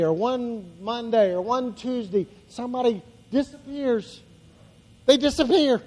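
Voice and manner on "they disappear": ordinary talk, nothing marked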